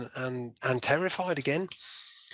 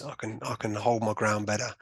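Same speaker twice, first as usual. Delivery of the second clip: ordinary talk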